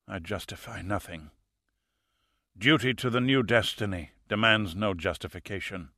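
a calm fanatic with little breath left; dying killer. A narrator's reading.

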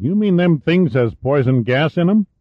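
high-pitched country disbelief; astonished folksy local. A narrator's reading. Local veteran questions colonel about poison gas, high and astonished. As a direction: ask it agog, as a rural veteran. Voices Lovell, shocked.

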